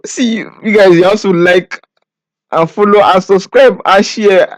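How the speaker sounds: high, loud, ecstatic celebration; giddy relief. Real, not acted. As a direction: shout with joy after winning. Just won the game.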